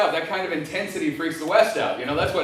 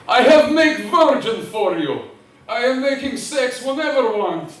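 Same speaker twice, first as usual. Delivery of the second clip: mock Russian bride; 3 semitones higher, 4 dB louder than usual. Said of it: "mail order bride from Russia".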